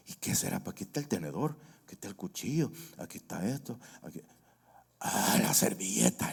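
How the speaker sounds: soft, hushed listing as she sets the table; quiet fussing wife. Retold or imitated. Wife serving her husband, hushed and fussing. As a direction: murmur it while laying out cutlery.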